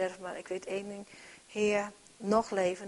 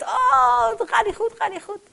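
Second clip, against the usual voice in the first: high, loud panicked wail from a crash victim; a trapped driver calling out in fear; panicked cry; 13 semitones higher, 9 dB louder than usual. Retold or imitated. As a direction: cry out in panic from the wrecked car. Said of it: "je roept en je zegt".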